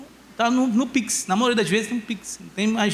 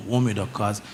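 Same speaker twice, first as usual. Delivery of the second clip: put-on much lower, puffed-up boy's voice; deep boy voice; 13 semitones lower than usual. Son proudly answering his father, in a deep voice. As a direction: drop the voice low like a proud little man. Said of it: "Ele fala até grosso".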